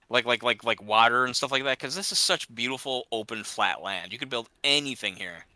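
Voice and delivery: ordinary talk, nothing marked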